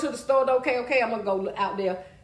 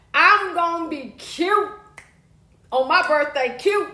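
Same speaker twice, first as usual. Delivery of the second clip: slow, higher, drawn-out boast; slow sassy brag about her birthday; 5 semitones higher, 4 dB louder, slower than usual. Real, not acted. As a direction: savor the word cute. Bragging about her birthday look.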